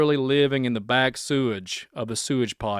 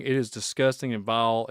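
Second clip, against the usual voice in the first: normal talking voice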